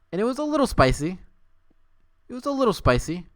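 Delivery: mock-coy high voice; teasing squeal. Real, not acted. Streamer teasing chat about the spicy fanfic, high.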